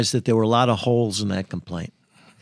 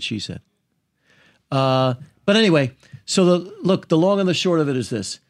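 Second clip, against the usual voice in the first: usual speaking voice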